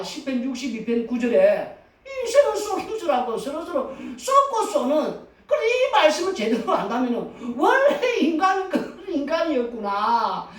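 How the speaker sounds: ordinary delivery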